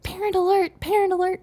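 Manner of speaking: high, playful imitation of kids calling out; mock kids yelling. Kids at the park warning each other, high mock yell. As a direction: sing it out like kids spotting grown-ups. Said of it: "they were yelling".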